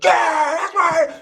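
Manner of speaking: hammed-up overjoyed coach yell; coach losing his mind. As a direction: scream praise like a wild coach. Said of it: "he, like, loses his mind".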